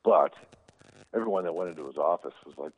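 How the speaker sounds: ordinary delivery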